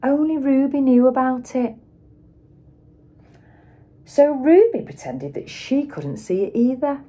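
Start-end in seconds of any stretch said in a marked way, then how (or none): none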